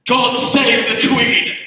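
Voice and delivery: high, loud and slow patriotic cry; proud cry. Acted. Englishman's defiant last words, loud and slow. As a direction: belt it out like a last salute. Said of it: "and he says".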